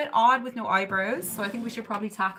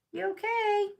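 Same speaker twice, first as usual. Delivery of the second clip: much higher, coaxing little voice; 12 semitones higher than usual. Real, not acted. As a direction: ask the cat sweetly. Checking on the cat.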